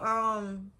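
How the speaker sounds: ordinary talk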